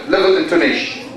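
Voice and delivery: ordinary delivery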